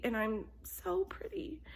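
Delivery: breathy whispered so pretty; whispered vanity. Acted. Vain cat whispers to herself about being pretty. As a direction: trail off whispering, admiring herself.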